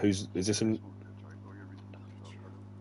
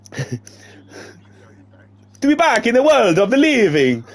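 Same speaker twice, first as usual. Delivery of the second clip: put-on high, loud singsong Italian accent; bad Russian sliding into comic Italian; Russian accent gone Italian; 13 semitones higher, 4 dB louder than usual. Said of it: "my Russian's Italian".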